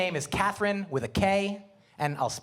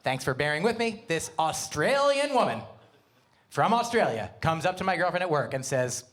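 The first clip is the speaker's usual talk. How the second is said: ordinary delivery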